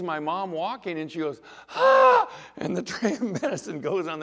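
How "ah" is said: very high, loud shocked gasp-scream; sudden loud cry of astonishment. Retold or imitated. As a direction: imitate a nurse's startled shriek. Nurse sees patient walking, gasps.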